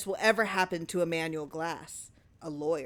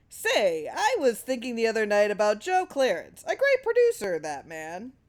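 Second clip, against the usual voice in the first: wife voice; 4 semitones higher, 4 dB louder than usual. A narrator's reading. Wife voiced by narrator, mocking.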